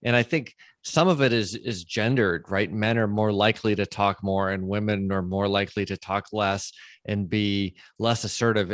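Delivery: usual speaking voice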